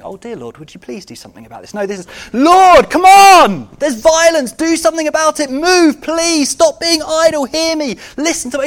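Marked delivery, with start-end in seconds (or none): 0.0-1.7 meek polite prayer. Acted. Preacher mimics the prayer Habakkuk isn't praying, meek and polite. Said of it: "This isn't Habakkuk going". 2.3-8.6 desperate high shout, demanding an answer; passionate crying out. Acted. Preacher acts out Habakkuk crying to God, impassioned shouted plea. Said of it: "he's crying out with passion and emotion".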